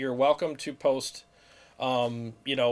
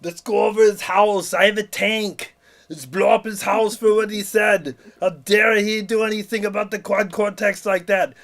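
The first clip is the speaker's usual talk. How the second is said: higher, louder, barking tough-guy threats; Arnold voice; 5 semitones higher, 6 dB louder than usual. Acted. Arnold ranting to the others, ready to attack. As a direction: bark orders like an action hero.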